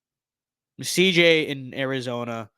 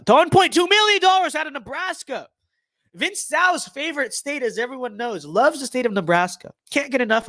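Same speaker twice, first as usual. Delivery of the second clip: excited; 9 semitones higher, 3 dB louder than usual. Real, not acted. Excited gag about Nebraska donation.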